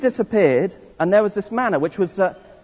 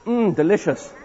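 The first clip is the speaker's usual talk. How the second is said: satisfied; 3 dB quieter than usual. Shown as a demonstration. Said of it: "you've got to be very satisfied".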